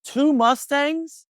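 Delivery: high, slow disbelieving cry; incredulous. Retold or imitated. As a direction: stretch it out in shocked disbelief. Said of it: "I was like".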